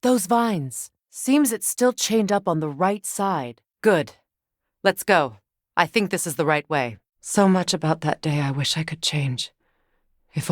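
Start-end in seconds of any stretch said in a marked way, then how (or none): none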